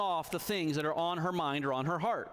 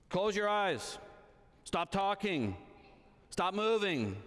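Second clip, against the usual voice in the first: bad dad; 5 semitones higher, slower than usual. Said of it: "A bad dad simply will give a command".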